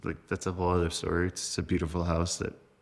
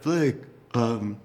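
imitating brother, higher and hesitant; halting, raised-pitch kid brother imitation; brother's old voice; 4 semitones higher than usual. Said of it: "old voice, his old voice".

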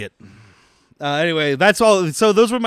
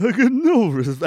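mixed-up impression; 6 semitones higher, 3 dB louder than usual. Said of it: "I try to mix them".

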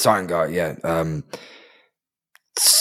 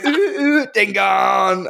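mock silly cry; 7 semitones higher, much slower than usual. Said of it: "It was like you go".

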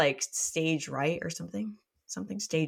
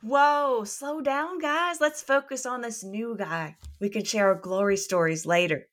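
excited hero voice; 6 semitones higher than usual. Acted. Character line in read.

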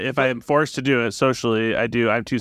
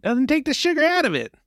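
put-on much higher, childlike voice; squeaky kid begging at a candy store; 12 semitones higher than usual. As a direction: play an eager little boy asking for candy. Child role in improv skit.

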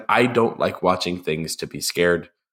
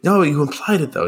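higher, lightly insistent son; retold protest; 9 semitones higher than usual. Son answering dad about the toast, higher mild protest. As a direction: imitate an earnest son pushing back.

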